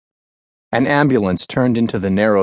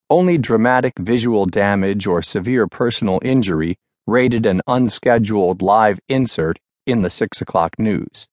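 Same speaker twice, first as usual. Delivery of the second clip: ordinary delivery, nothing marked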